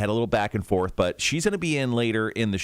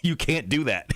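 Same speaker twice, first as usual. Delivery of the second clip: higher-pitched mock telling-off; replayed reprimand, more teasing than stern; retold scolding; 6 semitones higher than usual. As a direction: scold her playfully, pitched up. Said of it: "I scolded her for it".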